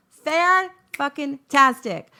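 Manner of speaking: loud, slow, stretched-out Fan-fucking-tastic; sarcastic delight. Retold or imitated. To the Airbnb offer, loud drawn-out mock delight. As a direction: drag out every syllable with loud glee.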